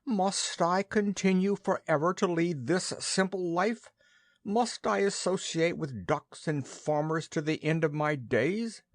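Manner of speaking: a touch of a self-pitying goose; lamenting goose. A narrator's reading. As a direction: moan over its simple life.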